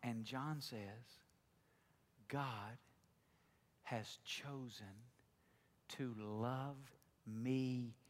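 soft, slow, low devotional reading; quiet intimate reflection. Acted. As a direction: share a private prayer slowly and softly.

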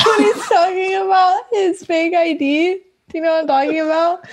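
high-pitched, talking through real laughter; laughing while explaining. Real, not acted. Explaining the Superbad fake ID bit, laughing. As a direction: barely hold the giggles in. Cracking up retelling a film scene.

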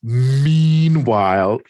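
slow, hammed-up transition; theatrical scene change. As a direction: stretch it out like a comic-book caption.

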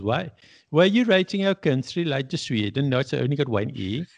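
imitation Swedish accent. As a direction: quote an indignant Swede in a put-on accent. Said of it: "I like your Swedish, uh, accent".

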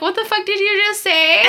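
voicing Sue, high and incredulous; shocked disbelief. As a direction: ask it like you can't believe your ears. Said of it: "Sue's just looking at him like".

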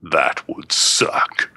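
slow, hushed whispered drawl; slow whispered catchphrase; Voltandra's catchphrase. Retold or imitated. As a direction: breathe it out slowly like a secret. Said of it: "borrow a line from my friend Voltandra's".

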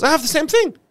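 very high, loud exclamation; amazed squeak of recognition, acted out; astonished patient. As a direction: marvel at the coincidence. Other patient's surprise, acted.

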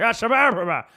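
loud nonsense babble answering a heckler; hammed-up slurred gibberish; mock gibberish. Talking back to the heckler in mock gibberish. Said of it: "talking back to him in his native tongue".

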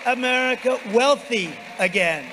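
loud, high closing refrain; roaring rallying speech. Real, not acted. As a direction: shout the slogan to the crowd.

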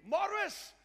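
high, loud retold cry of a name; imitated scream. Preacher voices a dying wife seeing her husband, imitated scream. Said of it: "a mom started screaming".

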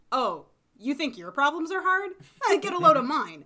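loud, laughing brag; loud boast. Retold or imitated. Boasting character quote, laughing.

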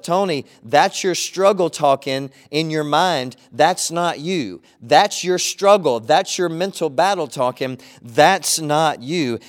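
slow, firm words to himself; self-talk. Retold or imitated. Pastor repeating what he tells himself, slow and firm. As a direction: tell yourself firmly, word by word. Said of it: "I simply tell myself".

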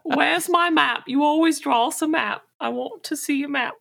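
high squeaky whiny plea; bright squeaky sing-song. Acted. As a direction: squeak it out, begging. Character pleading for a map.

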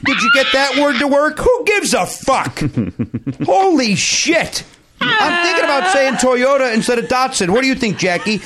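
high-pitched comic rant; mock outburst. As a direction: rant in exaggerated exasperation, mocking the podcasters' shop talk. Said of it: "That was an outburst".

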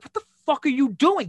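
high, loud angry demand; retold high, loud yell of anger; angry retort. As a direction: confront him furiously. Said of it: "and I'm like".

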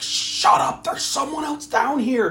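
high, strained hushed urgency; urgent hushing. Retold or imitated. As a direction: shush her in a mock panic, voice shooting up. Acting film character urgently hushing her.

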